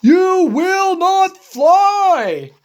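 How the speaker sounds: emphatic no. Acted. Gerald insisting to Piggy: loud and slow. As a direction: lay the words down hard. Gerald repeats, louder, slower.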